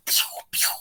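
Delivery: breathy whispered pew pew gunshots; whispered gun pews. Retold or imitated. Reviewer imitating gunfire in the chase, whispered pews. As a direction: mouth the shots in a whisper like a kid playing.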